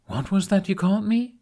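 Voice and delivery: high, curious question that lifts at the end; rising at the end. Acted. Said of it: "voice raising slightly at the end".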